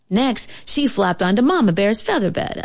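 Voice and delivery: ordinary delivery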